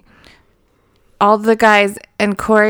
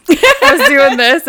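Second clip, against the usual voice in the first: laughing; 6 semitones higher, 7 dB louder than usual. Real, not acted. Laughing while telling.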